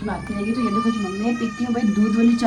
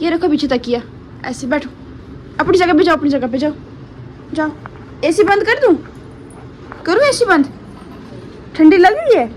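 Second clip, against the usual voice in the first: sing-song mummy voice; 7 semitones higher, 6 dB louder than usual. Real, not acted. Mother settling baby, asking if cold.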